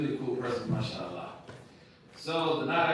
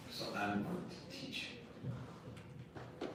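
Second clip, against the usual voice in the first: hushed mentor; 6 semitones lower, 12 dB quieter, much slower than usual. Retold or imitated. Teacher voiced in a story.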